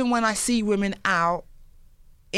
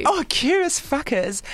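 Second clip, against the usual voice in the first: savoring the phrase; 6 semitones higher, slower than usual. Real, not acted. Savouring the phrase.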